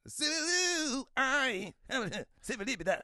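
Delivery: high shouted [name] yell; [name] impression. Imitating [name] to the podcast host, high and shouted. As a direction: belt it like [name] on stage. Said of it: "I think about Eddie Murphy as, [name]".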